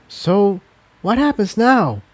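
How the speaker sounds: hushed, high mare voice; a nervous hushed question; Incendia low and hushed. Acted. Incendia asks hushed, unsure what comes next. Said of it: "her voice low and hushed".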